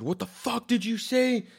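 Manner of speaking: high-pitched retold outburst; acting out an indignant, high challenge; pissed off. Insulted man turns back on the driver, pissed off. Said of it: "I, I got pissed".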